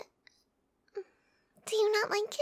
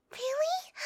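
gasping, higher, delighted; surprised gasp; 7 semitones higher than usual. Acted. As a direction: gasp then a rising really. Hears beach trip agreed.

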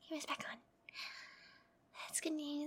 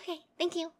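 normal talking voice

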